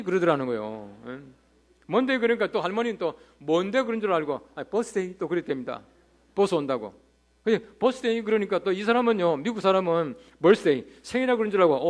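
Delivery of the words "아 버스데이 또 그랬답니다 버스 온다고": ordinary talk, nothing marked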